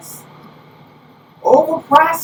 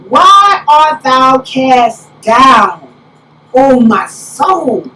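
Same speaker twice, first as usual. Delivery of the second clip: very slow, louder preaching lament; preaching the psalm; 3 semitones lower, 5 dB louder, much slower than usual. Acted. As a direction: draw out each word of the lament.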